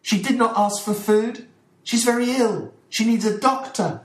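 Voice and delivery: high, loud reproach to her master; indignant cry. Acted. Dolores rebuking her master about her sick mistress, indignant. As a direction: throw it at him with indignation. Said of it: "looking with indignant eyes".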